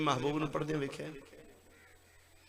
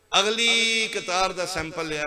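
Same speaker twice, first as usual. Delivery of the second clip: loud announcement; 6 semitones higher, 10 dB louder than usual. Acted. Angel presents next row's sample.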